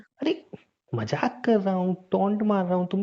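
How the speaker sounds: high-pitched pleading that it's a joke; backtracking protest. Acted. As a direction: voice shoots up in protest. Hastily says he was joking.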